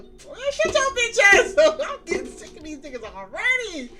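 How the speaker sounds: high, loud mock yell. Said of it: "He yells out the".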